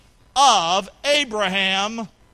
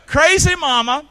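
loud call; 6 semitones higher, 9 dB louder than usual. Acted. Repeat of title line.

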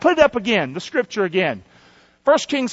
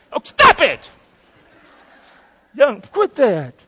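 mock scolding; 3 semitones higher, 3 dB louder, slower than usual. Preacher mock scolds congregation.

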